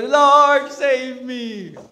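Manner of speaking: high, loud shout for help; desperate cry. Acted. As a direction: cry out like you're sinking. (malakas na sigaw ng paghingi ng tulong)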